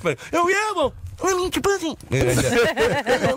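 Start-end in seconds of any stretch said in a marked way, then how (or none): 0.3-2.0 very high, sharp challenge. Retold or imitated. Angry neighborhood girl yelling at the catcaller, high and sharp. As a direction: snap back at him like a furious girl.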